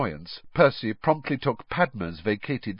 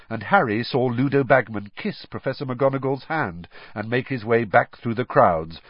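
ordinary delivery

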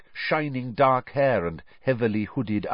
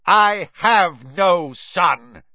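slow, loud, raised disowning; bellowed rejection; 7 semitones higher, 6 dB louder, much slower than usual. A narrator's reading. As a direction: bellow each word with finality. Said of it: "bellowed Mister Crouch".

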